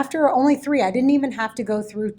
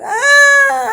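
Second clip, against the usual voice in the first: loud, much higher mock crying cry; imitated anguished wail; 12 dB louder than usual. Student sobbing on the bathroom floor, imitated loud wail. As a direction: let out a retold sobbing wail. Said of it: "So she's there crying, going".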